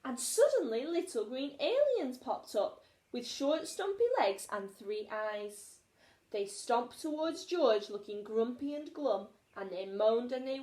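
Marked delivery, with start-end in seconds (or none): none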